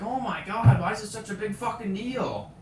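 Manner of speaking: loud, high retold exasperation; exasperated outburst. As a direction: burst out at the pointless fuss. Acts out exasperated inner reaction.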